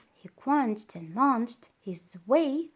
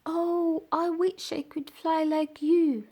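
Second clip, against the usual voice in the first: sighed wish; 6 semitones higher than usual. Acted. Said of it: "sighed Caterpillar".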